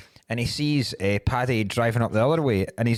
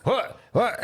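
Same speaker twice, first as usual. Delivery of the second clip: high, short burst of surprise; mock astonishment; 11 semitones higher than usual. Audience hears him sing well at karaoke, mock astonishment. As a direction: imitate a stunned listener, pitched way up. Audience amazed at his singing.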